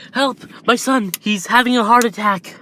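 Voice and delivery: desperate mum begging for help; frantic mother. Acted. Inko calling emergency for her son, frantic high plea.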